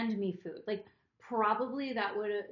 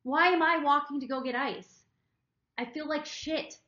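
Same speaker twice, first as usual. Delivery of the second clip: louder, fed-up inner rant; retold exasperation; 6 semitones higher, 6 dB louder than usual. Retelling her resentment at the camp, exasperated and loud. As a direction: vent like you've had enough. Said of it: "I'm just sitting there just like".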